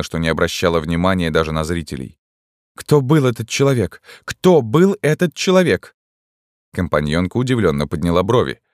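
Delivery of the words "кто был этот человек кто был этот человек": high, urgent questioning; agitated. A narrator's reading. Shaken woman grabs companion's arm asking, agitated. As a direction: demand an answer, climbing on the repeat. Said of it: "Она была так взволнована".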